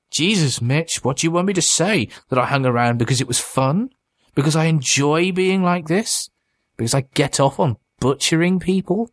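high-pitched, mocking rhetorical questions; irritated, taunting, voice lifted high; exasperated sarcasm. A narrator's reading. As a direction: toss out each question in rising disbelief. Creature's exasperated sarcastic retort.

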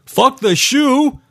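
very high, slow frustrated yell; yelling at the screen. Acted. Guard yelling at a woman on his monitor, frantic. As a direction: stretch it out in a high-pitched mock yell. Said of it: "Jim yelled at the screen".

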